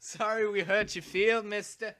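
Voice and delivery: put-on British accent, quoting a film line. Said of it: "that was a good British accent".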